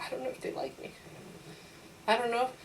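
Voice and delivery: whispered self-doubt, barely voiced; insecure whisper. Teacher doubting herself at home, whispering. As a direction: breathe it out like a worried secret. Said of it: "suddenly I'm like".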